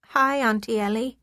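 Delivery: put-on a little girl's bright British hello, rising at the end; crisp British accent. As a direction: greet your aunt in a crisp British child's accent. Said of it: "spoken in her crisp British accent".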